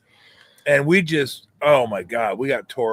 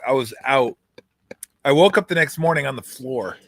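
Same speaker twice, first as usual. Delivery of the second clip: usual speaking voice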